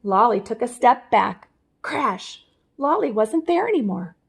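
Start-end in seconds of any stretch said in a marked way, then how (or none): none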